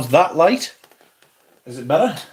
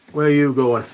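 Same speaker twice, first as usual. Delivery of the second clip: ordinary delivery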